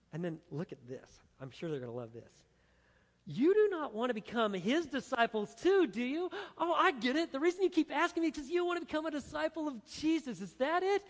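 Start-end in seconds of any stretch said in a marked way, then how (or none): 3.3-11.0 high, louder mocking jab at his questioners; sarcastic taunt. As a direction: needle them with a sarcastic sneer, pitched up. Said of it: "he throws that at them".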